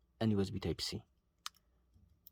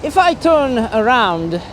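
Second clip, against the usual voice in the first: calling out from afar; 7 semitones higher, 5 dB louder than usual. Real, not acted. Shouting to distant camera outdoors.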